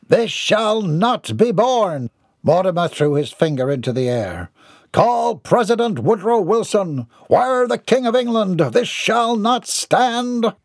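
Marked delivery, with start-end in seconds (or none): 0.1-2.1 high, slow, grand protest; outraged Mortimer. A narrator's reading. Mortimer throws his finger up, outraged. 4.9-10.5 high-pitched pompous rant; outraged Mortimer. A narrator's reading. Mortimer demands the president be called, outraged.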